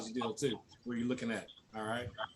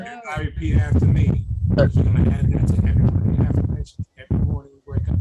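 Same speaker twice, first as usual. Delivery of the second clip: loud call to the team; rally the whole room; rallying coach; 2 semitones higher, 14 dB louder than usual. Real, not acted.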